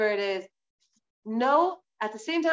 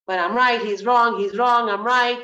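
louder, sing-song squabbling; mock bickering; 5 dB louder than usual. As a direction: rattle off a petty argument in even, punched beats. Mimics petty who-is-right squabble.

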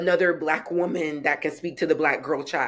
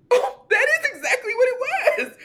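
high, laughing through the words; laughing recognition of herself in the book; laughing realization; 3 dB louder, faster than usual. Retold or imitated. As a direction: burst into delighted laughter as it clicks.

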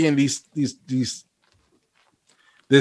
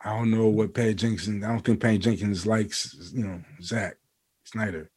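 much softer, low conspiratorial murmur; telling the wife softly during the movie; hushed aside to wife; 5 semitones lower, 11 dB quieter than usual. Retold or imitated. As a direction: whisper the excuse to your wife in the theatre. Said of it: "I was like".

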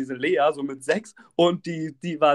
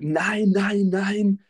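higher, stubborn repeated no; insistent refusal; 5 semitones higher, much slower than usual. Retold or imitated. Man refusing to put his mask on, insistent. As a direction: imitate someone refusing outright.

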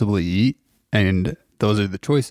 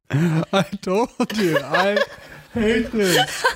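higher, slow, laughing through disgust; 8 semitones higher, much slower than usual. Real, not acted. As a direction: laugh hard while complaining. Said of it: "He's gagging".

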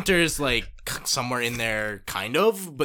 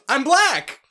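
higher, louder announcement; a hint of Dan proudly announcing; lightly voiced smug friend; mock proud declaration; 11 semitones higher, 8 dB louder than usual.

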